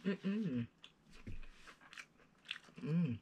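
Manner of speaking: chewing between words; talking while eating fries; mouth full, chewing. Real, not acted. Seen 2: Bites carrot fries repeatedly and chews throughout the murmured words.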